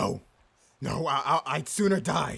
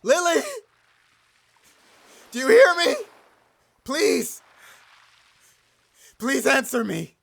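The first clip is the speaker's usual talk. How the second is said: loud, very high pleading cries; desperate shout; 11 semitones higher, 9 dB louder than usual. Acted. Blind captain crying out for Lily. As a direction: scream her name into the dark.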